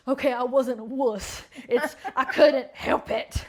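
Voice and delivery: loud, laughing protest; mock self-defence. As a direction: plead your case in mock indignation. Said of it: "and then I'm like".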